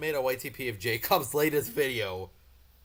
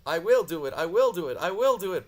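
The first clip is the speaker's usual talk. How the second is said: louder, higher, firm chant; determined puffing; 6 semitones higher, 7 dB louder than usual. Acted. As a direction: push through with determination. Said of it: "puffed Edward".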